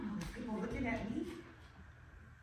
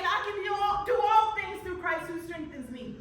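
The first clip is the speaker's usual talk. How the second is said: higher and louder declaration of faith; a preacher-like quote of the verse; emphatic scripture recital; 5 semitones higher, 9 dB louder than usual. Acted. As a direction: proclaim the verse with conviction. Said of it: "I'm always saying".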